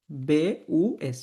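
বে উ এস spelled letter by letter, very slowly. Shown as a demonstration. German teacher slowly spelling the word for students. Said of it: "বাস না, বুস".

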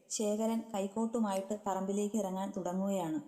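ordinary delivery

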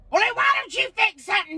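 snapping shout, fast. Acted. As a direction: yell it back at him. Yelling at husband on phone.

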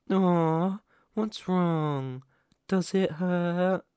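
high, drawn-out cooing taunt; mock sympathy. Captor taunts the pet just hurt, mock sympathy. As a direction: coo at them with false pity.

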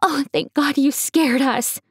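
high, worried, then slowing into relief; anxious relief. Acted. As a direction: rush to her, then breathe out. Mom relieved at waking.

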